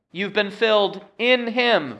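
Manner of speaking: "in" lifted high, "him" falling; stress on "in". Shown as a demonstration. Preacher reads verse ten, stressing "in". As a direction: punch "in" near the end of the verse. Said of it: "emphasizing the word in".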